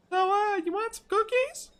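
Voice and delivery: very high falsetto cookie seller; high-pitched hesitant sales pitch. Acted. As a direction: offer it in a high put-on voice. Voices the cookie seller character.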